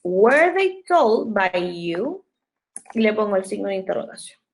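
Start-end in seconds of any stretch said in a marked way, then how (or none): 0.0-2.2 very slow question, word by word; slow dictation. Shown as a demonstration. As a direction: dictate it so they can write it. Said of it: "yo voy a poner acá". (pregunta dictada muy lenta)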